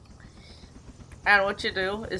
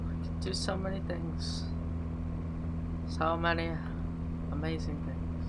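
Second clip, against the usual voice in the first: breathy unvoiced whisper of wonder; 3 semitones lower, 7 dB quieter than usual. Pilot dreaming aloud on the way, whispered. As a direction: whisper it to yourself in awe. Dreamy aside before the flight.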